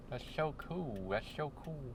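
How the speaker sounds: high, very soft put-on nerd voice; mock nerd, small and high-pitched. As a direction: geek out quietly with slushy s sounds. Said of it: "Gotta do the nerd voice".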